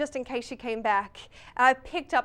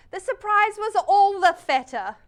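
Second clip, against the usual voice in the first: gleeful reveal; 7 semitones higher, 7 dB louder than usual. Pretend. Punchline of the vengeance menu.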